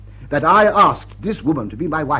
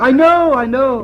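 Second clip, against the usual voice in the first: a high, loud cry of dismay, then settling; anguished outburst; 9 semitones higher, 7 dB louder, slower than usual. Acted. The fiancé admitting he knows his bride is a crook, pained high cry. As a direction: blurt it out in distress, then calm down.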